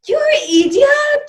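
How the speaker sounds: high, loud yell; screamed insult. Retold or imitated. Finding the earrings behind the pen, screaming at husband. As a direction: scream it straight at him. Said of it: "Scream at me".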